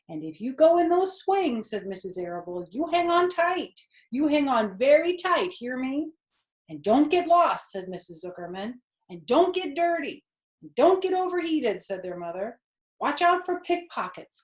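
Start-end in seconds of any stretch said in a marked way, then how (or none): 0.1-7.7 fussing mother. Acted. 9.1-11.8 fussing mother. Acted. 13.0-14.4 cautioning father. Acted. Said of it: "cautioned their father".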